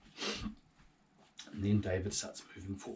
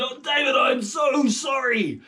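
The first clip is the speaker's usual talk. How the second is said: high shouted apology, slowing on sorry; a king yelling sorry after David; Saul's shout; 17 dB louder than usual. Acted. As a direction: cry out the apology across the distance. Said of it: "And he said".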